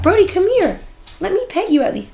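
high sweet pet-calling voice; talking to dog. Real, not acted. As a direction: coo at the dog to come.

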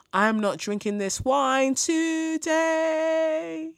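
high, stretched comic wail; mock terror. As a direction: wail it like you're about to die. Pretend terror in cellar.

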